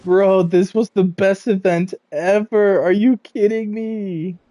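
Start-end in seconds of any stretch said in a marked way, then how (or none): none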